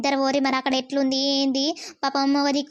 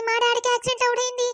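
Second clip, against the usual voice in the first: shocked daughter; 7 semitones higher, faster than usual. Acted. Daughter hears of father's accident.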